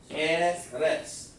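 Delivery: "Eretz" drawn apart as eh-rets; mocking demo of "Eretz", ending in ts; mimicked mispronunciation. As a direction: demonstrate "Eretz" with short E vowels. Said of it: "like that".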